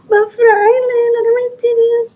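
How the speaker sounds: very high, loud mock-pleading voice; mocking impersonation. Impersonator messaging El Fraile on Facebook, mocking high voice. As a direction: pitch it way up and loud, hammed-up coaxing.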